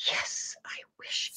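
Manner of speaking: soft unvoiced whisper; whispered wish. Shown as a demonstration. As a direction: breathe the wish out quietly. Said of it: "You have to go".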